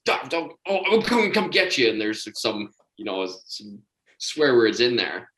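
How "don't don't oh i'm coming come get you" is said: high-pitched mock yelling, in broken bursts; imitated sleep screaming. Roommate yelling in his sleep, retold angry scream. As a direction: act out angry sleep-talking, building in volume. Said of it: "I just hear this screaming".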